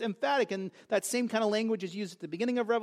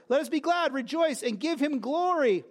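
exultant cry; 6 semitones higher, 3 dB louder than usual. Acted. The voice cries in the vision.

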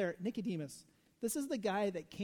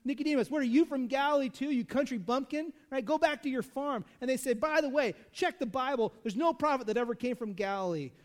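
higher, louder taunting sneer; mocking ridicule; 5 semitones higher, 6 dB louder than usual. Pharisees ridiculing Nicodemus as a bumpkin, higher and louder. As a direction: ridicule him like a country bumpkin. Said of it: "stereotyping them and judging them".

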